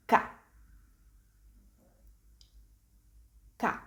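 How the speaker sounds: Ka as hard k plus open Italian A; Italian sound demo. Shown as a demonstration. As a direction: say Ka as one isolated syllable, "kah". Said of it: "pronounced K sound followed by the A sound".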